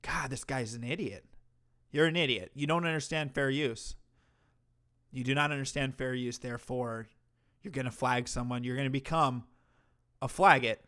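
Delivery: ordinary delivery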